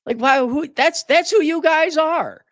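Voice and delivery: very high, incredulous accusation; exasperated outburst. Real, not acted. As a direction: pitch shoots up in exasperation. Ranting at joyless people.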